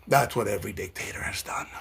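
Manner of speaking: sudden hushed whisper after the shouting. Real, not acted. Warning viewers about dictators, whispered. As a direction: drop it to a conspiratorial whisper.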